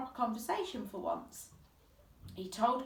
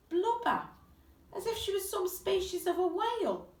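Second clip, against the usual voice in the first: cruel mockery; 10 semitones higher than usual. A narrator's reading. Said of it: "rather cruelly, started calling it".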